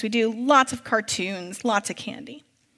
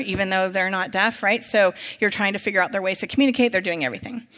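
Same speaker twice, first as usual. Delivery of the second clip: normal talking voice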